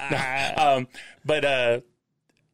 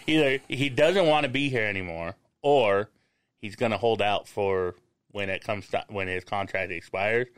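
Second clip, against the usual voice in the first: ordinary delivery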